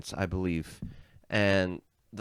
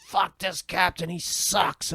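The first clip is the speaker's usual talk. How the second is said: higher, scornful rabble-rouser voice; rebellious pirate; 9 semitones higher, slower than usual. Retold or imitated. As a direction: stir up the crew against the captain. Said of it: "He's like".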